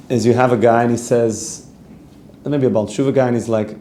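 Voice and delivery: ordinary delivery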